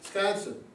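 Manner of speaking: Wisconsin shortened to 'Sconsin; local shortened pronunciation. Shown as a demonstration. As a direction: drop the Wi from Wisconsin, start on sk. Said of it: "you shorten the word up".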